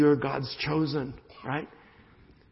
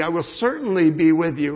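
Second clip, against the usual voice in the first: "certainly" punched and louder; stress on certainly; 7 dB louder than usual. Shown as a demonstration. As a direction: hit the word "certainly". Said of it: "There's even an emphasis on it".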